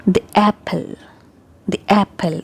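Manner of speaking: "the apple" with "the" as short "thee"; the before vowels. Shown as a demonstration. Teacher repeats a vowel example, short "thi apple". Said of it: "when it appears before a vowel sound".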